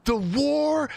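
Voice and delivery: high, strained, drawn-out hollering; protester on stage. Retold or imitated. Streamer imitating the protester at the Forrest Gump rally, high strained holler. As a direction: stretch every word like a rally speaker. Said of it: "that one guy ... he goes".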